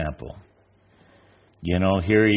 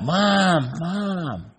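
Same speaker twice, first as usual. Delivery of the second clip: calling out high; 11 semitones higher than usual. Retold or imitated. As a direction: shout it up high like a blind boy reaching for Mom. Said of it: "Yelling out".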